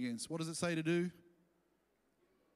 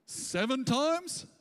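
higher, slow proud Seven times?; smug Peter; 7 semitones higher, 4 dB louder, much slower than usual. Retold or imitated. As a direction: stretch "Seven times?" up, pleased with himself. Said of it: "he doubled it and added one".

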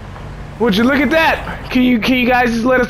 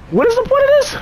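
exasperated. Real, not acted. Player baffled by the game, high exasperated question. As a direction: pitch jumps very high in disbelief.

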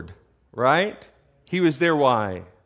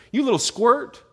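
high, loud scolding; acted sibling telling-off, raised voice; angry big brother; 9 semitones higher, 6 dB louder than usual. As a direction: bark it at a little brother, sending him home. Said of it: "he was angry".

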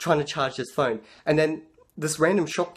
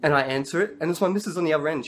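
ordinary talk

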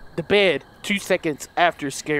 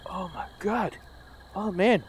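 ordinary delivery

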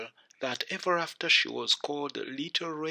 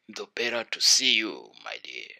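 wolf as grandmother; 7 semitones lower than usual. A narrator's reading. Wolf posing as grandmother answers girl, low voice.

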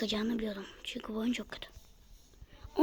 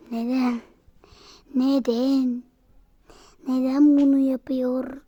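exasperated why; 2 semitones higher, 8 dB louder than usual. Real, not acted. Frustrated at the game.

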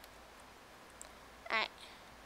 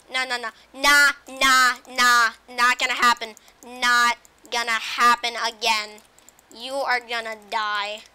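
loud defiant; 12 dB louder than usual. Real, not acted. Fighting off a mob.

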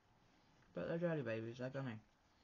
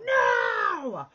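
shout; 14 dB louder than usual. Retold or imitated. Stopping husband eating candy.

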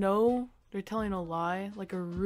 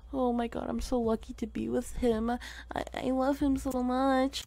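lightly voiced gushing girlfriend, a bit higher; girly Snapchat gush; 5 semitones higher than usual. Mimicking a Snapchat girl gushing about her boyfriend. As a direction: gush like a lovestruck girl posting online. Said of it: "Why was that such a good impression".

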